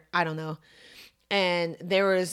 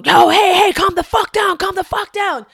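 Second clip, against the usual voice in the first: high, loud retold yell to calm someone down; 11 semitones higher, 9 dB louder than usual. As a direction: shout it across the field, much higher and louder. Someone yelling at her teammate.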